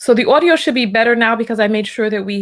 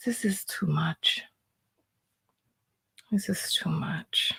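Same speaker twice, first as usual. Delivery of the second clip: breathy whispered disbelief, slowly; overwhelmed whisper; 3 semitones lower, 12 dB quieter, slower than usual. Real, not acted. Reacting to a video, whispering overwhelmed. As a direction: whisper it like you can't take any more.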